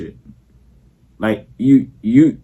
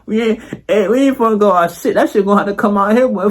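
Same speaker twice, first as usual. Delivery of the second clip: higher, rushed street-friend voice; hyped friend pushing to go inside; Terrell voice; 5 semitones higher than usual. Retold or imitated. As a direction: play hype friend Terrell, urging. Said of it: "What you heard, Terrell?".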